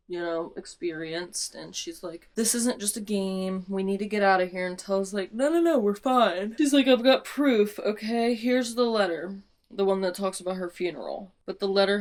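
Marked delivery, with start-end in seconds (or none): 2.3-9.4 heated argument. Retold or imitated. Scarlet and Tella argue whether the game is dangerous, loud. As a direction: push each line louder, pleading.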